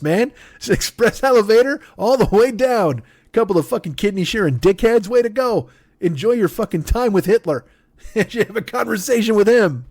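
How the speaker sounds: high-pitched exasperated rant; ranting Jesus. Acted. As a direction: scold everyone like a fed-up Jesus. Jesus damning organ sharers.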